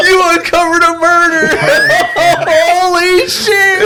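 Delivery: high, slow, laughing disbelief; excited laughing. Real, not acted. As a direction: stretch out the shock through laughter. Uncovering the father's murder story.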